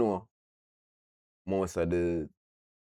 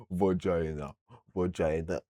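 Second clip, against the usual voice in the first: slow, softened, drawn-out "vagina"; mock professional voice; 2 semitones lower, 3 dB quieter, slower than usual. Shown as a demonstration. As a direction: say "vagina" with exaggerated formality. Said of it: "it sounds too professional, like".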